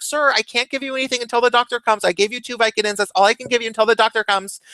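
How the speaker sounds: high, fast nurse voice; exasperated nurse. Retold or imitated. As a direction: rattle off the rules like a tired nurse. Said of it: "she's like".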